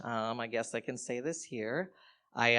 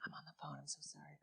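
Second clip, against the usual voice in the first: soft unvoiced whisper, sorry to interrupt; whispered apology; 2 semitones higher, 21 dB quieter, much faster than usual. Comic passing a canvasser on the phone, whispering. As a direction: whisper it past them. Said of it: "I was like".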